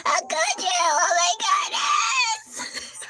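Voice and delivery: very high, slow wail with laughter; overdone heartbroken cry; mock wailing. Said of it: "He's sobbing".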